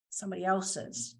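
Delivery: ordinary delivery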